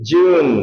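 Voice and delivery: June with a gliding, twisted u, said strongly. Shown as a demonstration. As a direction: bend the vowel of June. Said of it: "ইউ এর ভিতরে একটু twist হবে".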